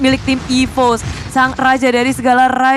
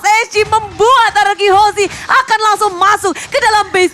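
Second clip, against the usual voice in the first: high shouted victory call; fighting game announcer; 8 semitones higher, 3 dB louder than usual. Real, not acted. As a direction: belt out the win, stretch the end. Calling the game-winning push.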